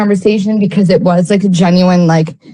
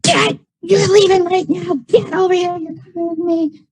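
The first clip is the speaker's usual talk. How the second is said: angry mom; 9 semitones higher, 3 dB quieter than usual. Retold or imitated. Angry mom dragging son away.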